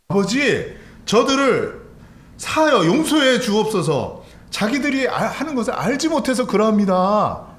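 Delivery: high, pleading prayer of Jesus; fervent preacher voicing a prayer; impassioned prayer. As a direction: cry out in prayer from the cross. Preacher voices Jesus praying on cross.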